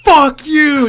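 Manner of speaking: loud, high-pitched mock fury; retold angry yell. Drunk friend yelling at bartender over the receipt, mock furious. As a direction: hurl it back, imitating a drunken quarrel.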